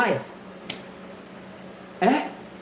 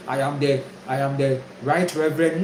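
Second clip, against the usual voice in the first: lower, mock self-important pastor; pompous reverend; 6 semitones lower, 3 dB quieter than usual. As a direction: puff it up like a proud reverend, lower. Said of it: "in front of the woman today".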